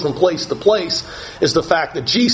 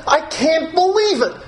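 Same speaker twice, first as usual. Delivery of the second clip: much higher, dismayed disciple voice, slowing; perplexed disciple; 11 semitones higher than usual. Pretend. Disciple watching Jesus leave, high dismayed disbelief. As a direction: voice the stunned, bewildered disciple. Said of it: "as if to say".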